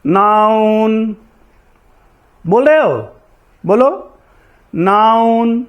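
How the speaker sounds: slow, drawn-out model words for students; repeat-after-me drill. Shown as a demonstration. Teacher leads class chorus, slow and deliberate. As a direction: say each part of speech slowly, pausing for class to echo. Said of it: "मेरे साथ बोलो".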